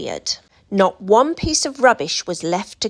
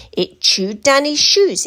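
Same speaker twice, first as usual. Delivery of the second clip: plain reading voice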